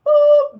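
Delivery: a sudden high, loud "boop" for the spray; a playful high boop; boop sound. Shown as a demonstration. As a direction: pop out a cartoon "boop".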